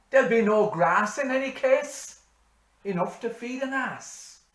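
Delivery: loud, high, ringing recital; bush poem recitation. Acted. Reciting Says Hanrahan for parishioners, loud declaiming. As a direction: declaim the ballad to the whole parish.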